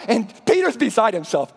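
ordinary delivery

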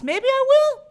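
high and loud, teasing; playful high exclaim. Real, not acted. Reading a chat question, she teases back loudly.

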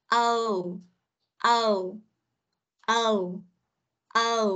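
O as a glide from o to u; diphthong demo. Shown as a demonstration. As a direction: model O repeatedly, rounding off into u. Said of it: "O sang U".

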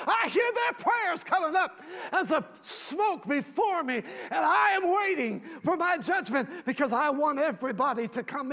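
a hint of a patient Father who grows fervent; God voiced, building to preaching. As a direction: start calm, then rise into a loud fervent plea.

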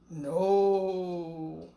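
low, drawn-out creature no; a lazy beast answers slowly. Acted. Creature under the stairs gives a low, slow no.

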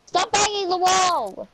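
irritated shout; real annoyed yell. Real, not acted. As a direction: yell at someone off-mic to stop. Yelling at someone banging the wall.

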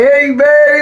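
high, loud cheery hey babe; boyfriend greeting. Retold or imitated. Boyfriend coming home with new TV, loud high greeting. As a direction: call out proudly like a man home with a prize. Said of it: "He was like".

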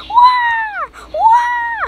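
high startled wah yelp. Real, not acted. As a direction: yelp in sudden alarm. Jumping in game.

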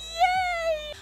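ordinary delivery

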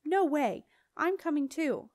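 high defiant shout over rushing air. A narrator's reading. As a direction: shout to be heard over the wind. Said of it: "Jenna shouted above the whooshing of air".

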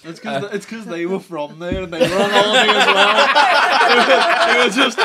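loud, high, laughing through the words; real helpless laughter while talking loudly; laughing hard. Real, not acted. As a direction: barely get it out for laughing. Cracking up at own justification.

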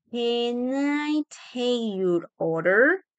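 very slow, drawn-out question, rising on "order"; slow teaching pronunciation, word by word; slow model. Shown as a demonstration. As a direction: stretch every word for learners. Teacher models phrase slowly.

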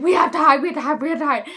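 panicked. Retold or imitated. Kids panicking as lady approaches.

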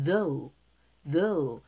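THOUGH said alone with voiced TH and falling glide; "though" pronounced slowly as a teaching word; careful TH. Shown as a demonstration. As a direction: model the TH of THOUGH clearly. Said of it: "발음해볼게".

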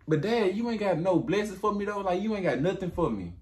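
high, hurt whine; Esau whining. Retold or imitated. Esau whining to his father, in a high hurt voice. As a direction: plead high to Dad. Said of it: "He said".

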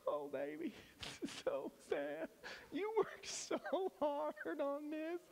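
very high, cooing Southern sympathy; Southern accent. Acted. Mercy gifting consoling the woman over her cake, Southern accent. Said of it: "I get a Southern accent".

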